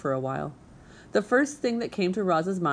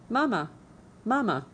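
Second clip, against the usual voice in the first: higher, a lightly voiced chick calling; gosling calling mama; 5 semitones higher than usual. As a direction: call out like a little gosling. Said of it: "peeping gosling".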